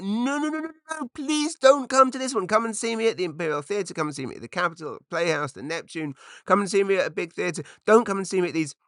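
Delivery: high, frantic mock begging; a flustered comic pleading its case; panicked pleading. Panicked plea not to come.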